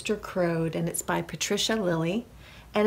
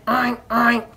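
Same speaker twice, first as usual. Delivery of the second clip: two loud, short oinks; pig oinks; 3 semitones higher, 7 dB louder than usual. A narrator's reading. Pigs helping wake the farmer, oinking. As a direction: oink like a pig, twice. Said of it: "When the pigs went".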